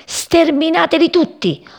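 high, forceful battle command; commanding order. A narrator's reading. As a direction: order the troops to charge. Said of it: "ordinò il nuvolone con un rombo".

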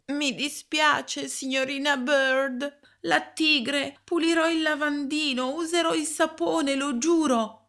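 put-on pleading child. A narrator's reading. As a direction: beg her forgiveness in panic. Frightened child pleads with teacher. (bambino che supplica la maestra, molto acuto, spaventato)

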